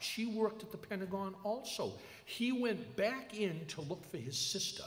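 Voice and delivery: a hint of a father in tears; grieving father.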